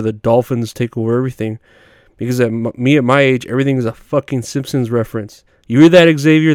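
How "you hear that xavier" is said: calling out. Real, not acted. Calling out to a friend.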